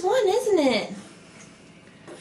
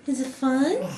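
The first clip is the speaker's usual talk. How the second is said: ordinary talk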